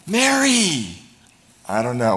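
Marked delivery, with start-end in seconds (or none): 0.1-1.0 "Mary" cried out high and loud, a sudden call; shouted Mary. Shown as a demonstration. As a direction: call out "Mary" with full joy, voice jumping up. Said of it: "hear the inflection".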